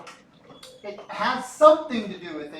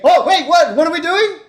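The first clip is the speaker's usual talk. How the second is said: louder and higher, a staged double take; mock sudden surprise; 9 semitones higher, 11 dB louder than usual. Shown as a demonstration. Teacher shows students how he breaks their focus, mock startled. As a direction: jolt the class with a fake startled what. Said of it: "Because when I say".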